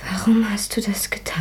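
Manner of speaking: hushed, urgent whisper; pleading whisper. Acted. Friend asking the killer why, whispered. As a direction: ask it under your breath.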